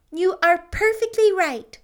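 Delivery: emphatic agreement. A narrator's reading. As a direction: agree with total conviction. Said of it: "said Anne emphatically".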